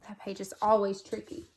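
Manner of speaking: usual speaking voice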